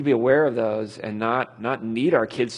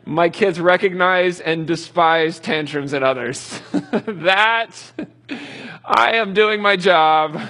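laughing through the words, slow and louder; 4 semitones higher, 5 dB louder, slower than usual. Real, not acted. Dad laughing while bragging about his kids. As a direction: chuckle it out, proud of yourself.

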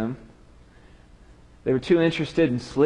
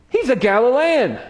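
high, louder pointed accusation; accusing bystander; 11 semitones higher, 5 dB louder than usual. Acted. Bystander to the crowd about Peter: high pointed accusation. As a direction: nail him as one of them.

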